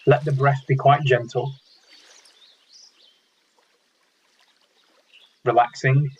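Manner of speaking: calm meditation guide with long pauses between phrases; unhurried, measured instructions, leaving silence after each; guided meditation. Real, not acted. Meditation leader guiding an online mindfulness group, calm and spaciously paced.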